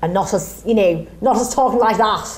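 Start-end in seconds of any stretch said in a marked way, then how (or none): 1.2-2.3 nasal broad Scouse demo; nasal Liverpool accent. Shown as a demonstration. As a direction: show how nasal broad Scouse sounds. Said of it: "'Cause it's quite nasal".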